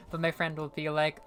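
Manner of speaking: usual speaking voice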